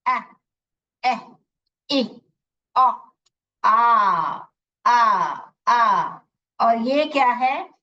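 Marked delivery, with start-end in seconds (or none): 0.1-2.9 ए ई ओ each clipped short as a sound; short vowel sounds. Shown as a demonstration. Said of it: "उसका sound निकालना है". 3.6-6.2 आ held long and loud; drawn vowel sound demo. Shown as a demonstration. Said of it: "उसका sound निकालना है".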